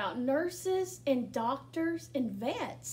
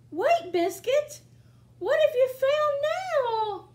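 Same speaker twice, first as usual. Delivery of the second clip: much higher, louder, slow curious reading; much higher, slowed, curious reading to children; excited storytime reading; 12 semitones higher, 8 dB louder, slower than usual. Acted. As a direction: build the kids' suspense. Reads picture book aloud.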